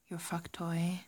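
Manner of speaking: usual speaking voice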